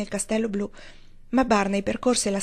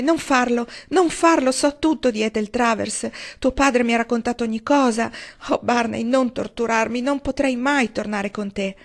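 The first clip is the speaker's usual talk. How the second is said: higher, louder desperate begging, slowing at the end; anguished pleading; 4 semitones higher, 4 dB louder than usual. Acted. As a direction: plead against being tortured. Valency begs him to stop. (supplica angosciata, più acuta e forte)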